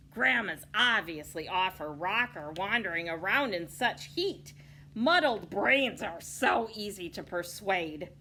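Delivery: loud, gloating cackling wolf; a wolf sneering at granny. A narrator's reading. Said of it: "the wolf cackled".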